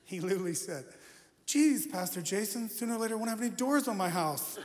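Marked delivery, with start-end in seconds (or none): 1.5-4.6 Kip voice, whiny. Retold or imitated. Youth leader grumbling about losing doors, Kip whine. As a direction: whine it like a put-upon geek. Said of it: "he sounded just like Kip".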